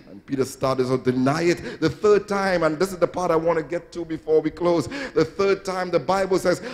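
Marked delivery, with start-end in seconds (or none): none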